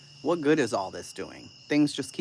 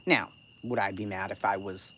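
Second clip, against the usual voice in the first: normal talking voice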